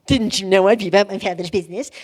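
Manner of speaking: put-on fast, smart-mouthed teen retort; sarcastic teenage back-talk; cocky teenager. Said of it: "I don't think he said it cocky".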